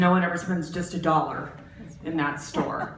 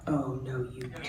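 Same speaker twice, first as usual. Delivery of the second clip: low sassy murmur; 8 semitones lower, 8 dB quieter than usual. Retold or imitated. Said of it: "I'm like".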